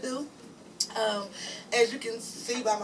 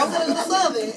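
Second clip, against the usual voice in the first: quoted decision, higher and louder; excited declaration; 7 semitones higher, 7 dB louder, faster than usual. Retold or imitated. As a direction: announce it with a laugh in the voice. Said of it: "So I was like".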